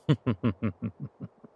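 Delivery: staccato villain chuckle, trailing away; rippling, fading vampire chuckle; sinister laugh. Acted. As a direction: laugh indulgently at your own dark joke. Vampire amused by captive prey.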